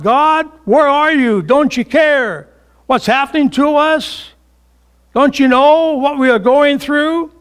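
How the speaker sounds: high, loud, pleading questions; acted anguished outburst from the pulpit; blurted cry to God. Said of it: "sometimes we blurt out".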